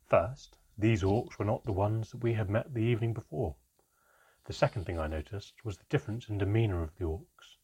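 ordinary delivery

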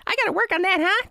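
very high mock self-critique; hammed-up high imitation; mimicking Shannon. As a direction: parody someone fumbling an accent. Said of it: "never wanna hear Shannon say".

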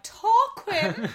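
high laughing mock posh Tarquin; imitating Ryan saying Tarquin. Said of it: "it's the way Ryan says it as well".

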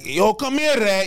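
put-on high-pitched Greek-accented call; imitating a Greek friend's accent. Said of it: "He was Greek, Greek, still big accent".

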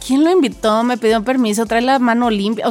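ordinary delivery

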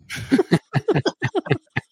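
a high burst of laughter at the punchline; amused laugh. Real, not acted. Laughing after telling the Andre ice story.

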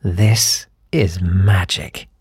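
low, very slow station announcer; radio ident voice. Retold or imitated. Radio station ident between somber tracks, low slow announcer voice. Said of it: "a voice would just go".

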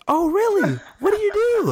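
high, breathless gushing lady voice; mock impressed lady. The lady gushing at Bomani, in a high mock-impressed voice.

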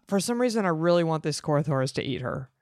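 ordinary talk

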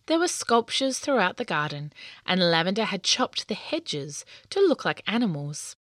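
ordinary delivery

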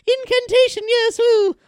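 very high, airy fairy voice. Retold or imitated. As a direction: sing it out like a giddy fairy. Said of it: "that's a more of a fairy vibe".

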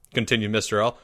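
plain reading voice

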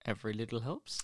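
put-on soft slogan in a German-sounding accent; quiet mock-German accent, rising at the end; weird accent. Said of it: "say that in a weird accent".

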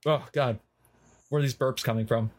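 ordinary talk, nothing marked

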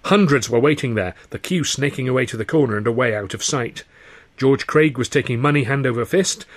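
ordinary delivery, nothing marked

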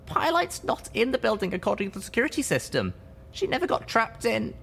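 lifted light voice for a friend; high character voice. Acted. Character dialogue, high put-on voice.

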